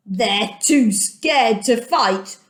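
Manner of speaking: slow, taunting enemy voice; mocking city folk. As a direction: drag it out like a sneering guard. Jericho people mock the army.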